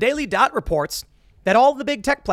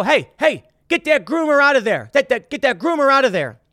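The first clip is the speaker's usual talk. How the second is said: loud, raised voice, higher pitched, calling across the playground; 8 semitones higher, 6 dB louder than usual. Retold or imitated. At the playground, calling out the groomer, loud raised shout.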